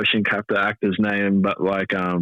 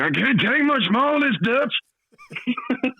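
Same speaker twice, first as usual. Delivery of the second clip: quoting a game character; 13 semitones higher, much slower than usual. Retold or imitated. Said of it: "I'll just be like, quoting Red Dead".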